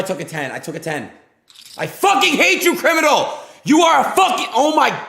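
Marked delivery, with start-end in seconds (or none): 1.8-5.0 screaming at the top of the voice, much higher and louder; furious scream. Real, not acted. Streamer raging at the player who took his card, screaming. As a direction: explode in real rage at a player.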